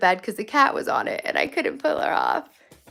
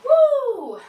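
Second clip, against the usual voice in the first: excited whoop; 5 dB louder than usual. Real, not acted. Cheer at organized room.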